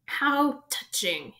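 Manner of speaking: drawn out with scorn; mocking hiss. A narrator's reading. Voldemort mocking Harry's bravery, slow hiss. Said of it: "it hissed".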